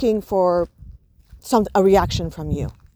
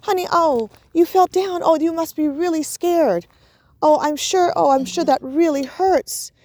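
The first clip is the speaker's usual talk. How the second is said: higher, sympathetic pacing voice; matching the child's upset; 8 semitones higher than usual. Shown as a demonstration. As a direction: sound as upset as the hurt child. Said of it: "And you're pacing them".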